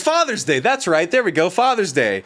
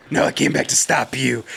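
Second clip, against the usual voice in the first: Batman voice; 7 semitones lower than usual. Retold or imitated. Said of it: "worst fucking Batman exchange".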